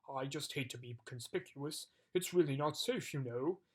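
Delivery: a huge giant fretting shyly; timid giant. Acted. Giant voiced throughout.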